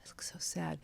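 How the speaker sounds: soft, breath-heavy retold dismay; retold self-pity. Seeing her own reflection in Macy's, retold quiet self-pity. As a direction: let it sink to a near whisper, mildly sorry for yourself.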